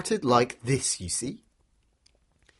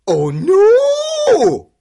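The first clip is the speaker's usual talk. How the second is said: high, loud, drawn-out "oh no" wail; big, long storybook wail of alarm; dismayed cry; 11 dB louder than usual. A narrator's reading. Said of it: "he cried".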